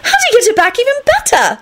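very high, eager question; eager high-pitched child's curiosity; curious demand. A narrator's reading. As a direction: demand to know, breathless with interest. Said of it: "Rosie demanded curiously".

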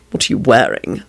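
low, intimate question. A narrator's reading. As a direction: drop low to flirt on the phone.

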